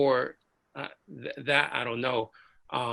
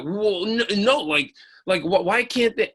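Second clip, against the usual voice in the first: higher, stammering protest; flustered, raised-pitch backpedalling; flustered objection; 6 semitones higher, 4 dB louder than usual. Retold or imitated. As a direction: imitate someone stumbling to argue back. Sergeant flustered when challenged, retold.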